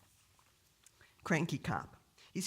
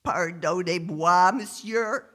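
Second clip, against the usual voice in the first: loud, drawn-out mock French; ridiculous French accent; 2 semitones higher, 8 dB louder, slower than usual. Stoned driver messing with the cop, ridiculous French accent. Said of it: "in a most ridiculous French accent".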